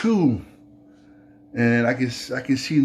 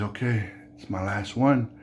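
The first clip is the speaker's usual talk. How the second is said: resigned giving in; 5 semitones lower, 6 dB quieter than usual. Acted.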